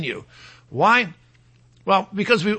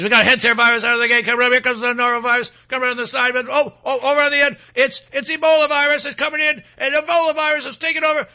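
fast, climbing race-call commentary; rattling play-by-play, rising in excitement; horse race announcer; 4 semitones higher, faster than usual. Acted. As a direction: call it like a tight finish at the track. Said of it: "it's like a horse race".

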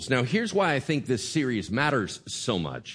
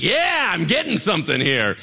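loud mock-excited cheer; really excited; 3 semitones higher, 4 dB louder than usual. Pastor voicing congregation awaiting their daily bread, excited. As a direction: cheer like an eager taker. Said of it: "then we really get excited".

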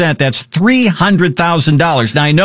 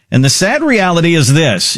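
usual speaking voice

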